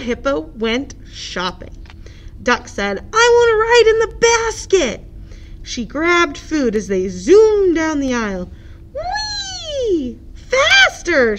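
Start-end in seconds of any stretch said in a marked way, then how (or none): 3.1-5.0 put-on high, louder childlike duck; eager duck voice. Duck voice in story. 8.9-11.4 very high squealing whee; gleeful squeal. Acted. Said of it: "squealed Duck".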